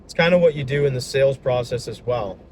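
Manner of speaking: ordinary talk, nothing marked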